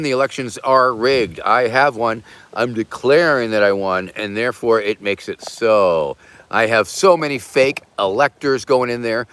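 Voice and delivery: ordinary talk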